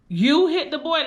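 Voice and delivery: high, loud stunned question; can't believe his own mother did it; shocked question. Retold or imitated. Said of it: "he couldn't understand".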